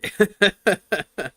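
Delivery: bright burst of laughter; laughing. Real, not acted. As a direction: laugh out loud. Laughs after own remark.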